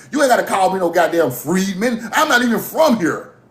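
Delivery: ordinary delivery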